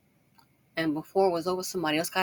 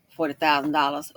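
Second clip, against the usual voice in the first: usual speaking voice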